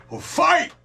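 high, loud mock ref shout; referee call. Streamer imitates a referee starting a fight, mock shout. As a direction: bark it out like a referee starting the bout. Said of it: "That's when the ref say".

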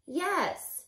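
usual speaking voice

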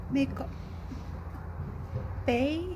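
usual speaking voice

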